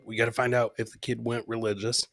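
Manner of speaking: ordinary talk, nothing marked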